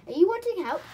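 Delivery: high, loud storybook creature; little merman. A narrator's reading. Merman offers the children help, high and loud. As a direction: ask brightly in a small creature's high voice.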